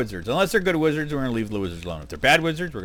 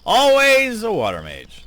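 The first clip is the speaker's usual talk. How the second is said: higher, louder and slow, fed-up; exasperated groan; 11 semitones higher, 8 dB louder, slower than usual. Real, not acted. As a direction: drag it out in weary disbelief. Exasperated at bad luck.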